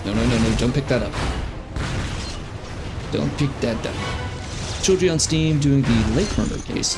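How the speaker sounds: usual speaking voice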